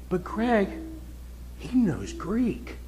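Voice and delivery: slow, soft mock old man, drawn out; a hint of an earnest old churchgoer; imitating the old fellow. Old fellow answers the preacher about Calvinism, softly earnest. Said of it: "He looked at me and says".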